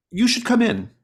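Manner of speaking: high, loud inviting call; eager invitation. Acted. Mystic invites the visitor in, high and loud. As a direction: beckon them inside.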